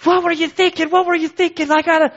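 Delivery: high, loud frantic repeating; hammed-up panicking dad; mock panic. Dad retelling yelling at his daughter in mock panic.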